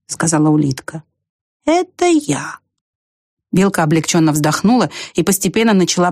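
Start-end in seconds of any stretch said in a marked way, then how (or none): 1.6-2.6 high, drawn-out snail voice; unhurried little creature; slow snail. A narrator's reading. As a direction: stretch it out, rising high. Same slow snail speaking.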